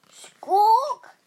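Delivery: loud, high hen's squawk. Acted. Narrator voicing the alarmed hen.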